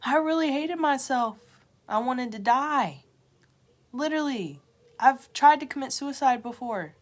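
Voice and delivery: raw, rising confession; emotional outburst. Real, not acted. Confessing past self-hatred to viewers, emotional outburst. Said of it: "I was so lost".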